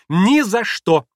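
high, loud, adamant refusal; shouted girlish protest; crying out. A narrator's reading. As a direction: cry out a refusal sharply. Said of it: "вскричала Лис".

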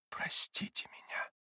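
slow, anguished whispered plea; moaned near-whisper. A narrator's reading. Katya begs Grushenka's forgiveness, moaning in a whisper. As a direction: whisper it as a faint moan. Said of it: "и тихо, почти шёпотом простонала ей".

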